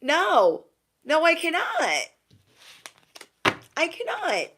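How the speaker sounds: high, drawn-out mock refusal; exasperated refusal. You answering the fake crier, exasperated refusal.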